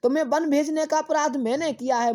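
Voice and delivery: fast, high, pleading queen; high and quick confession; impassioned confession. Acted. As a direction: blurt out the guilt. Kaikeyi confessing, voiced by teacher.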